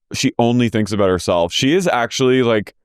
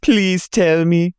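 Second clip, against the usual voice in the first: much higher, slow, put-on whiny voice; high, slow parody of a castmate; imitating Whitney; 8 semitones higher, much slower than usual. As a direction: mimic her pleading, drawn out. Mimicking Whitney in recap.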